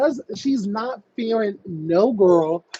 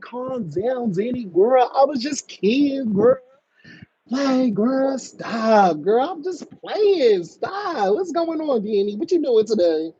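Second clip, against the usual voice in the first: higher, lilting teasing plea; playful mock soothing; 5 semitones higher than usual. Host imagining joking with an angry caller, playful coaxing. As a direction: pretend to coax a friend down, higher voice.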